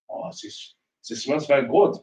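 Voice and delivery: imitating a German guest in a light German accent; mock German visitor sniffing the whisky. To the host, a German guest sniffs eagerly in a German accent. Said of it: "Like your German accent".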